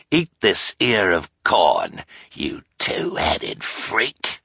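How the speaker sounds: slow, breathy threatening hiss; menacing hiss. Acted. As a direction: hiss it with contempt. Olaf continues snarling.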